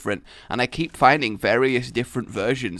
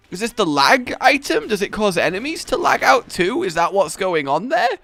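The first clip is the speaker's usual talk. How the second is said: ordinary delivery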